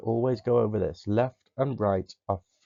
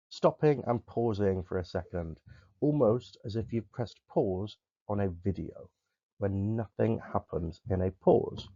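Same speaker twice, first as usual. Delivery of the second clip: ordinary talk, nothing marked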